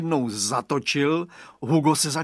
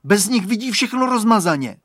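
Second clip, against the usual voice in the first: high, loud, urgent order; shouted demand; 7 semitones higher, 7 dB louder than usual. A narrator's reading. Boy ordering the bully to return glasses, shouting. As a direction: insist at raised pitch and volume. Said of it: "vykřikne Radovan".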